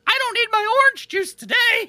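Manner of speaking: high, loud goofy voice; silly excited voice. Retold or imitated. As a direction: shout it like a gleeful nut. Voicing Richard gleefully.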